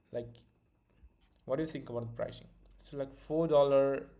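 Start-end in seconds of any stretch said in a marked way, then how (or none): none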